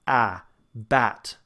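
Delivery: "ah, bat" with a forward open vowel; front ah demo. Shown as a demonstration. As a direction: place "ah" at the front. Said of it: "keep it to the front".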